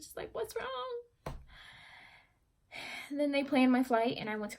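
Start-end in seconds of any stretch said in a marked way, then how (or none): 0.3-1.3 brother's concern. Retold or imitated. Voicing her brother coming upstairs.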